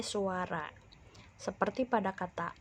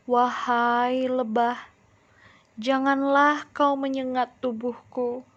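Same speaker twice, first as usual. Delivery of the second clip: poem recitation; 2 semitones higher, 4 dB louder than usual. Shown as a demonstration. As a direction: recite it boldly to the class. Teacher recites the poem Lebah.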